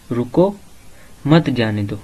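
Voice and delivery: रुको rising, then a break before मत जाने दो; pause after रुको. Shown as a demonstration. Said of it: "word वही है लेकिन उनके डिफरेंट मीनिंग". (रुको के बाद ठहराव, फिर मत जाने दो)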